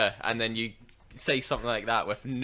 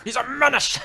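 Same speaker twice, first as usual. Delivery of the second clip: high, loud mock yell; mock shout; 11 semitones higher, 6 dB louder than usual. Friend imitating the angry boss, hammed-up and loud. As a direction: echo the boss line with a big hammed-up yell.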